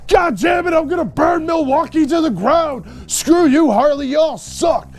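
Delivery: high, shouted pretend rage; hammed-up outraged fan yelling at Harley; mock furious rant. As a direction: blow up like a betrayed fan threatening the town. Enraged Harley fan mocked.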